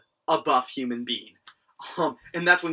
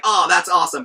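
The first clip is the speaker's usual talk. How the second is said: excited; 3 semitones higher, 9 dB louder than usual. Retold or imitated. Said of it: "like a normal person".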